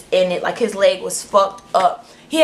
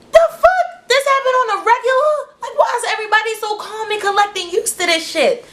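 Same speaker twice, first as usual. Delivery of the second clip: very high, squeaky outraged questioning; mock freak-out at everyone's calm; incredulous high squeal; panicked disbelief; 12 semitones higher than usual.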